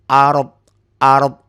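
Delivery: "ard" said loudly with a hard final d; stopped pronunciation. Shown as a demonstration. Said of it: "mulai fil ard". ("ard" keras dengan d mati)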